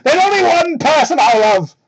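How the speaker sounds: high, loud mock angry declaration; out of anger. Sonic declaring his love in anger, loud ham. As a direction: fling it out in hammed-up anger. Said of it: "Out of anger".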